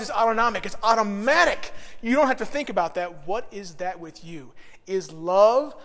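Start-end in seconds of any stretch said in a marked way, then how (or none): none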